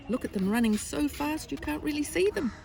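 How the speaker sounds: high-pitched storyteller's wonder; amazed. A narrator's reading. Reader showing listener the racing tigers, high amazement.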